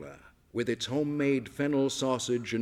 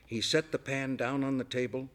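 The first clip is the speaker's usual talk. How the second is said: usual speaking voice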